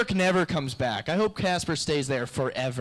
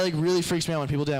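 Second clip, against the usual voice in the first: usual speaking voice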